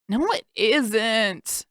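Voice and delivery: slow drawn-out whine; whining. A narrator's reading. Han whining to the healer about his injury. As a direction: stretch it out like a sulky patient. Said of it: "Han whined".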